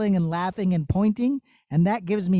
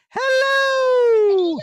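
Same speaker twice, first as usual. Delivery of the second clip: shouted squeaky high hello; long high falling hello; 4 dB louder than usual. Shown as a demonstration. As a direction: hit the highest note you can. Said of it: "Say hello as high as you can".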